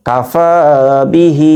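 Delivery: কাফা বিহি with long ফা and long হি; one-alif elongations. Shown as a demonstration. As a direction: keep কা and বি short, stretch ফা and হি. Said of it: "ফা এক আলিফ টান". (কাফা বিহি-তে ফা ও হি টেনে পড়া)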